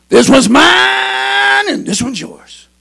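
high, very drawn-out shout on mine; eerie booming call. Acted. Boys overheard by grandpa at graveyard, drawn-out shouted call. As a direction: wail out mine long, then drop back. Same call, dramatically louder.

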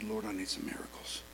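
hushed whisper to God; whispered prayer. Retold or imitated. Preacher whispering a desperate prayer. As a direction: breathe the plea almost silently. Preacher retells praying in panic.

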